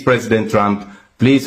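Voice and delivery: ordinary delivery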